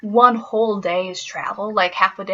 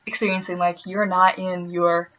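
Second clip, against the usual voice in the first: ordinary delivery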